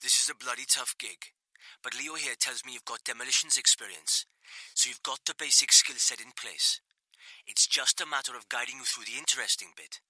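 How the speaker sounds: a hint of Welsh, reassuring over the line; Welsh accent.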